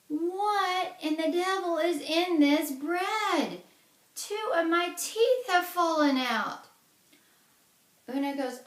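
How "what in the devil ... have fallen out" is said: much higher, louder, slow wailing cry; pained outburst. Acted. Giant biting iron bread, wailing high and loud. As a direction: howl in shock as his teeth break.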